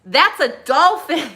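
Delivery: high, loud, slow southern drawl; southern kid accent. Retold or imitated. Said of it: "why he has a southern accent".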